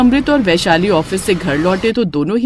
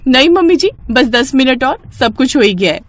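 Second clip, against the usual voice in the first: higher, brighter, eager to please; eager bride; 4 semitones higher than usual. Acted. Bride reassures mother-in-law from kitchen, eager higher voice. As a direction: call out happily from the kitchen.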